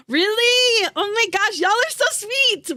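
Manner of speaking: overwhelmed and grateful; high gushing thanks; touched gasp. Real, not acted. Touched by chat.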